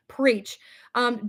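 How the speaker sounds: usual speaking voice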